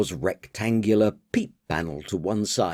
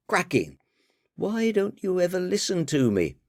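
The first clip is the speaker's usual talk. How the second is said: higher, exasperated daughter voice; 7 semitones higher than usual. Acted. Daughter chiding her father about the party, higher voice. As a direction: scold Dad for never listening, higher.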